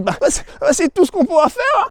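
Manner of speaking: high, hesitant mock accountant voice; meek accountant. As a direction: whimper it like a sheepish accountant. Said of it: "il dit".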